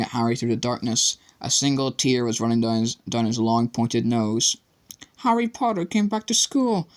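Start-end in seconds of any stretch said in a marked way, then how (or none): 5.2-6.9 high, squeaky miserable house elf. A narrator's reading. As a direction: mourn it like a tearful elf. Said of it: "he whispered miserably".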